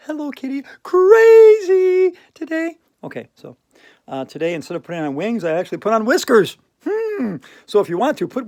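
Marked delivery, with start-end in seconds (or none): 0.1-2.8 much higher and louder squeaky cartoon voice; Hello Kitty crazy mode. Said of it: "in Hello Kitty crazy mode".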